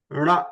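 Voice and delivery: "ra" as a nasal with tongue folded to the roof; retroflex nasal. Shown as a demonstration. Teacher demonstrating murdhanya, tongue curled back on "ra". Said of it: "fold your tongue and touch the roof".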